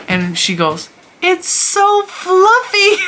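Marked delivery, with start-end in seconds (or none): 1.2-3.0 put-on very high, slow, drawn-out little-girl delight; four-year-old niece. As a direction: gush like a Disney princess, way up high. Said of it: "very high".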